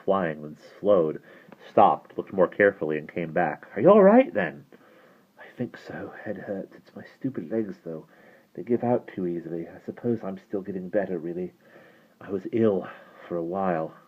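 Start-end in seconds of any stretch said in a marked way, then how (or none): 3.8-4.6 high, loud questioning call; concerned call. Acted. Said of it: "Bran yelled cheerfully over his shoulder". 5.4-13.9 soft, faint admission of feeling unwell; weak and giddy. Acted. Giddy, panting boy admits weakness.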